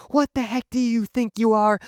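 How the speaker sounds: high-pitched outraged yell, imitated; mock screaming. Furious sister up in a teen's face, mock screaming. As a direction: imitate an angry girl blowing up. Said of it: "just starts screaming".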